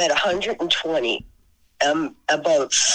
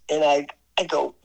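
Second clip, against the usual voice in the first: normal talking voice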